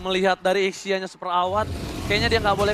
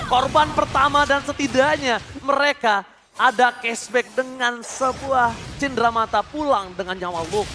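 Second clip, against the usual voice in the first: usual speaking voice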